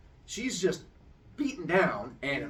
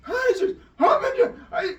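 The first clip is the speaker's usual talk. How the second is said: high, loud, broken-off panic; freaking out; 10 dB louder than usual. Retold or imitated. Storyteller as crowd seeing the hanging, freaking out. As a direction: sputter in mock alarm, words tumbling unfinished. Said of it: "freaks out".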